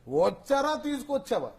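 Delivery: high, questioning boss impersonation; Rao Gopala Rao. Acted. Boss quizzes his secretary, high-pitched impersonation. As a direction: push the question up high in character. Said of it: "Rao Gopalarao గారు".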